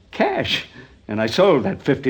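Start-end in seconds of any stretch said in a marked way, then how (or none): 0.1-0.7 savoured cash. Acted.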